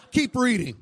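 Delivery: high, loud command to keep reading; loud order. Retold or imitated. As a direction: bark at him to go on. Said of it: "He says".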